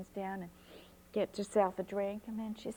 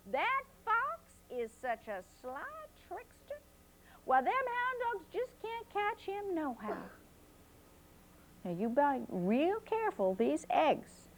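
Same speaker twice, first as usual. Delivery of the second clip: grandma's warning; 8 semitones higher than usual. A narrator's reading. Grandma warns about fox.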